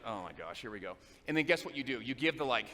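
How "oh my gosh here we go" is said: much lower and softer, weary; inner groan. Retold or imitated. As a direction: sigh it out under your breath. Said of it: "And you're like".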